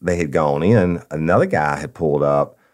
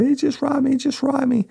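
much higher, tearful imitated victim; crying. Robbed clerk crying to the customer, imitated sobbing. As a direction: voice the clerk's upset, retold. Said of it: "comes out and she's crying".

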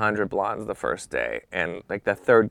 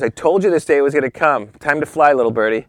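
louder, higher, grandly teasing goodbye; loud mock send-off; 6 semitones higher, 8 dB louder than usual. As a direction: boom it out like a proud mentor. Boss pushing him out nest.

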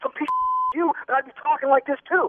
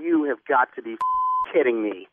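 stunned disbelief; 11 semitones lower, 3 dB quieter than usual. Real, not acted. Prank victim to the radio caller on learning the truth, stunned disbelief. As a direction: let it sink in, low and halting.

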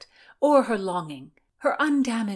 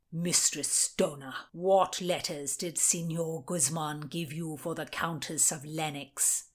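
stern interrogating lord; 4 semitones lower, 4 dB quieter than usual. A narrator's reading. Lord William Cecil interrogates Constance about letters, lower and cold. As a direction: question her like a judge.

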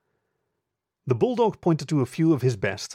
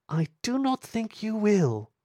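much higher, slow, silky taunt; smooth aristocratic lady; 10 semitones higher, slower than usual. Acted. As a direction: purr it out, supremely confident. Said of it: "Her voice was smooth, almost aristocratic".

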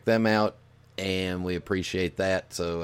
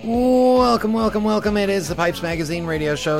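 high, loud hammed-up host greeting; big show welcome; 12 semitones higher, 7 dB louder than usual. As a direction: belt the welcome like a showman. Host's big show opening welcome.

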